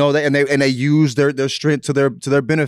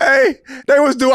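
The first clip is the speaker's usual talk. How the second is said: shouting, much higher and louder; excited shout; 10 semitones higher, 3 dB louder than usual. Real, not acted. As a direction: yell it out, all fired up. Hyped recap of Celtics defense.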